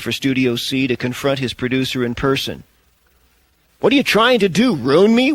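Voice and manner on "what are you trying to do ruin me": loud furious yell; angry yell. A narrator's reading. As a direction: yell it at the producer. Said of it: "Wes yelled at Bailey".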